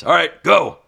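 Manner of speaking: loud yell to start; shouted command. Acted. Coach shouting at the knife thrower to go. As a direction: call it out like a coach starting the round. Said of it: "and he says".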